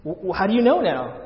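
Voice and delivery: acted surprise, tripping over the first word; somewhat surprised. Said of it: "somewhat surprised".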